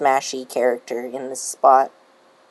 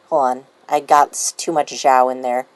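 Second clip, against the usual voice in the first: ordinary delivery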